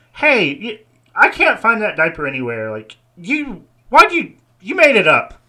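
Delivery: high, loud, whiny complaint; whiny teen worker. Acted. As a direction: accuse them in a high whine. Pimpled worker voiced by GM.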